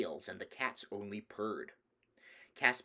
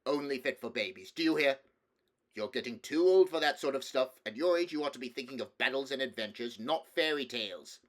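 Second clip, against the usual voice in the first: louder scolding uncle; stern; 4 semitones higher, 6 dB louder than usual. A narrator's reading. King telling nephew to give up fairy tales, stern. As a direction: lay down the law to a child. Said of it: "said the king sternly".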